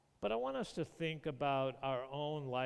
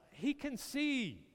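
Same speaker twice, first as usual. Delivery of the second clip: amazed exclaim; 12 semitones higher, 6 dB louder than usual. Real, not acted. Preacher marvels at the miracle.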